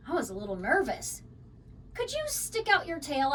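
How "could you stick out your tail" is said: usual speaking voice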